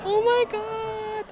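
high, slow squealed exclamation; ticklish squeal. Real, not acted. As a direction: squeal it, drawing it out. Still tickled.

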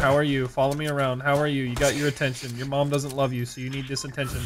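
low, deadpan mock friendliness; sarcastic taunting. Real, not acted. Streamer taunting an attention-seeking stream sniper, sarcastic. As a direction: quietly needle the pest in a monotone.

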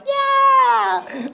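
put-on high, shouted little-kid whoop; kid's cheer. Five-year-olds on the coaster, cheering high. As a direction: cheer like a tiny child. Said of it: "little five-year-olds are like".

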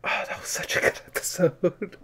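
a whispered sigh of wonder after a great episode; awed whisper. Real, not acted. Viewer hushed after the episode ends, whispering in awe. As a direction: breathe it out without voice, awestruck.

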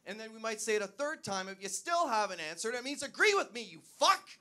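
building from loud to a high furious shout; hammed-up blow-up demanding agreement; escalating aggression. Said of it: "start heightening it if you don't answer".

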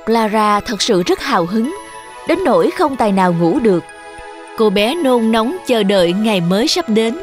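ordinary delivery, nothing marked

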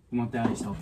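ordinary talk, nothing marked

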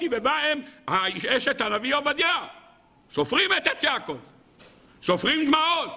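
high, emphatic proclamations in short bursts; fervent preaching. Real, not acted. Rabbi assuring listeners every cry is heard, proclaiming.

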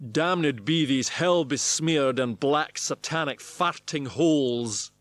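slow, loud Scots witch-hunter bellow; Scots accent. Acted. The warlock hunter cursing in a slow, loud Scots accent. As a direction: roll it out in a grand Scots accent. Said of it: "with a straight face and Scots accent".

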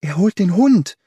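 high, loud cry of alarm. A narrator's reading. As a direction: call out a sudden warning, high-pitched. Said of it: "rief Miss Hunter".

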